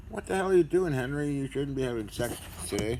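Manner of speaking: high, loud mock scolding; imagined listener scolding. Imagined viewer scolding Henry, mock-shocked yell. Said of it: "Somebody playing the video will hear this".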